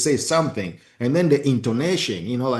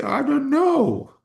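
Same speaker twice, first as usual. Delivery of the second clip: much higher, slow, mock tearful voice; imitating crying wife; slower than usual. Husband imitating his crying wife's answer, higher and teary. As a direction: play the wife, helpless and teary. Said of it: "She would just cry and say".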